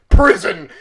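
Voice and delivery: a loud, laughing movie-title announcement; mock-epic "Prison!" with a laugh; mock title card boom.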